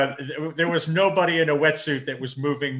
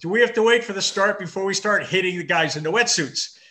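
mock brash American accent; hammed-up loud American accent, higher; 6 semitones higher, 4 dB louder than usual. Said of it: "in my best American accent in Ireland".

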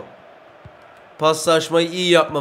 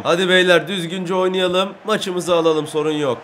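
usual speaking voice

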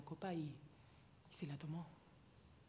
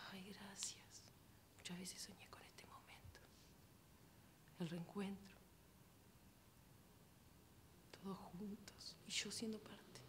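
soft, airy and higher-pitched; hushed, moved thanks; 4 semitones higher, 9 dB quieter, slower than usual. Acted. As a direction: thank them almost in a whisper, with long pauses. Acted thanks to guests at table.

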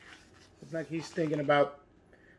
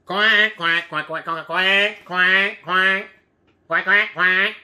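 duck quacking; 2 semitones higher, 6 dB louder, much slower than usual. Pretend. Ducks voiced in story.

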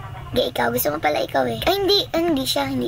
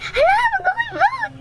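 high squeal while laughing; laughing panic. Real, not acted. Gamer begs other players not to vote for her, squealing. Panicking about being voted.

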